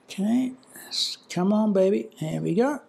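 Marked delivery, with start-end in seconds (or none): none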